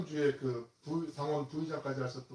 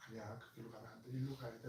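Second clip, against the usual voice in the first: gentle coaxing; 3 semitones lower, 13 dB quieter than usual. Acted. Shepherd Lord gently turning sheep.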